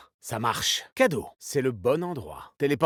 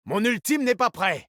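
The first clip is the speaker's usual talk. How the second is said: high, pressed callout; urgent callout; 7 semitones higher than usual. Acted. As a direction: warn the team urgently. (annonce pressante, voix aiguë)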